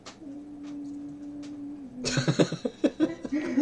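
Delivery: high, delighted cackling laugh; a long real laugh at the screen. Real, not acted. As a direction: burst out laughing and let it run. Long laugh at a drawing game.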